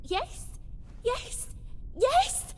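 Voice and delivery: rising giddy yeses; excited yes. Acted. Godling delighted by the deal.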